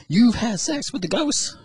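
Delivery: very high, slow mock disbelief; acted astonished pastor. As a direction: stretch it out in hammed-up amazement.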